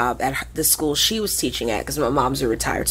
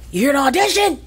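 higher, louder clipped question; Yogi's brisk question; 9 semitones higher, 4 dB louder, faster than usual. Retold or imitated. Group leader Yogi asking the newcomer, higher and louder. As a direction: voice the group leader sizing her up. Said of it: "she's like".

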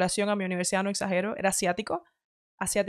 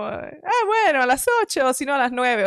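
lightly mocked laid-back planner; easygoing shrug; 5 semitones higher than usual. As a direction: shrug off plans like nothing matters. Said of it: "como a mí me gusta más como".

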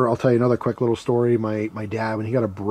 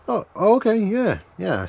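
high, quick eager yeahs; eager kid agreeing; 9 semitones higher than usual. Retold or imitated. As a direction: agree fast and high, keen to go. Kid sent down hill again.

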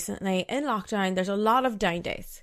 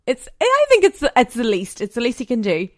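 much higher and louder, defending herself; indignant outburst; 4 semitones higher, 9 dB louder than usual. Real, not acted. Justifying her Valentine's expectations to listeners, indignant loud burst. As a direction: burst out insisting it's the least he could do. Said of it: "Do I sound like a spoiled brat right now?".

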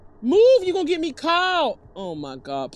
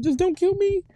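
ordinary delivery

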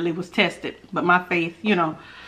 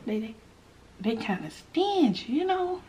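quiet; 7 dB quieter than usual. Real, not acted. Confiding to viewers about stingy housemates, hushed. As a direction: keep it hushed and a bit slow. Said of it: "I don't know why I'm whispering".

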